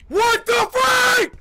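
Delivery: high, loud, stretched-out shriek; startled scream. Real, not acted. Gamer surprised by the creature, startled scream. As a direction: scream it in sudden shock.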